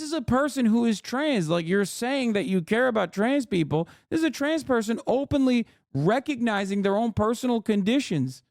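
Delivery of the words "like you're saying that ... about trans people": normal talking voice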